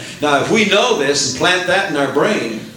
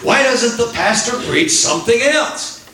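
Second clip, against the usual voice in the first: much higher, whiny churchgoer grumble; mock complaint; 9 semitones higher than usual. Preacher imitating a churchgoer grumbling about sermons, whiny mockery. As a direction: sneer it like a disgruntled parishioner. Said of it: "they leave church and they say".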